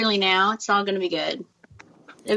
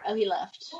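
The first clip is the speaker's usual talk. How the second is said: ordinary talk, nothing marked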